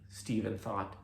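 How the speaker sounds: usual speaking voice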